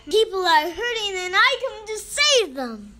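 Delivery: high, very loud proclamation; voice of God. A narrator's reading. God promises Moses to save his people, thundering. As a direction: shout it out as a rescuing God. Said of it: "said the voice thundering".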